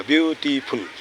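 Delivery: Beautiful in three separate beats, ब्यू-टी-फुल; broken into syllables. Shown as a demonstration. Teacher explaining syllables to students, word split in three beats. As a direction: stop after each syllable of beautiful. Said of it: "तीन बार लग".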